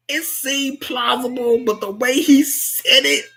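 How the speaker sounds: high-pitched talk breaking into laughter; laughing. Real, not acted. Telling viewers homie tried to dip, laughing hard. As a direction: crack up through the words. Cracking up at the scene.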